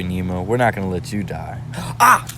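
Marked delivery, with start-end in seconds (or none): 2.0-2.3 startled gasp. Real, not acted. Startled by the fight.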